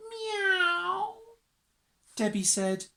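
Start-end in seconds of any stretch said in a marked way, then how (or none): 0.1-1.2 high, long, wailing meow; big cat meow. A narrator's reading. Narrator voices Mog's big meow, drawn-out wail. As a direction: meow the word like a complaining cat.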